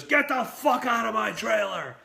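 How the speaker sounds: high angry yell; Burt Reynolds screaming. Retold or imitated. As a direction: scream them out, imitating the star's rage. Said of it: "starts just fucking screaming again at me".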